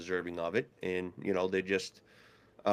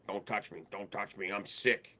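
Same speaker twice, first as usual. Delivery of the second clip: feeling sick; 8 dB quieter than usual. Retold or imitated. Sick coach refusing handshake.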